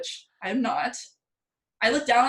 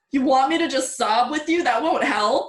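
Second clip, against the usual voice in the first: louder exasperated retort to a friend; 5 semitones higher, 5 dB louder than usual. Retold or imitated. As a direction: fire back at a crying friend. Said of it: "And I was like".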